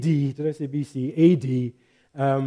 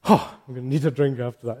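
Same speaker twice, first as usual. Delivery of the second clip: ordinary talk, nothing marked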